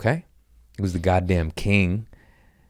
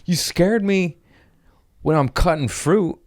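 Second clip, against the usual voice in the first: much higher, pleading protest; defensive high squeal; 11 semitones higher, 4 dB louder than usual. Retold or imitated. To the friend he stabbed, a high defensive whine. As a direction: whine your excuse up high. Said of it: "I was like".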